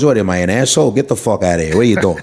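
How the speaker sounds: put-on Italian accent. Comic showing how New Yorkers turn Italian, Italian-American accent. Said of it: "you turn Italian".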